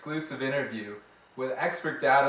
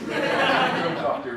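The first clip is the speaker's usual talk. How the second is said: slow words through a laugh; 5 semitones higher, 4 dB louder than usual. Real, not acted. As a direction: still chuckling as you speak. Host laughs after odd guest.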